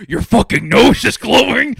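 father mocking his son's glowing nose, mock yell; mock angry dad. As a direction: bark it like a disgusted reindeer dad. Donner scolding Rudolph, retold.

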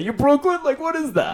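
mock Brooklyn accent. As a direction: quiz him in a put-on Brooklyn accent. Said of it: "I wanna hear your accents".

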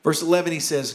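ordinary talk, nothing marked

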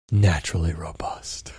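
breathy whisper of the name; hushed, secretive whisper. Pretend.

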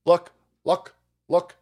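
hammed-up press secretary stalling; mimicking press secretary. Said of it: "Look," you fucking dead".

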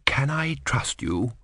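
slow breathy whisper; whispered question. Acted. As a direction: whisper it so the eagle can't hear. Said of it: "said Fryatt".

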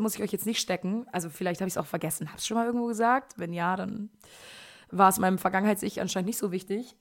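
ordinary delivery

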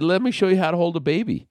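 high, fast, take-charge voice; brisk instructing. Retold or imitated. Taking the baby back from David, brisk and high. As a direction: rattle it off high like a busy mom.

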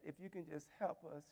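low, hushed words with long pauses; halting, very soft appeal to the king; quiet plea. Acted. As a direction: let each word fall softly, with gaps. Esther pleading with the king.

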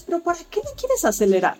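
mock-puzzled imagined objection; questioning challenge. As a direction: question it, voice lifted high.

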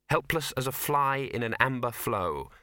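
plain reading voice

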